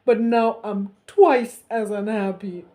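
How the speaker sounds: loud, slow sobbing; hammed-up wailing king. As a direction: wail in misery. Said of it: "he wailed".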